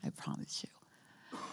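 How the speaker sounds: hushed, breathy repeat of the promise; whispered promise. Real, not acted. Whispering a promise to the listener. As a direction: whisper it close, like a vow. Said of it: "But I promise you it's leading to joy".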